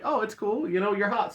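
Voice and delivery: light, high-pitched mock nonchalance; breezy forgiveness. Character forgiving her revealed lie, imitated breezily.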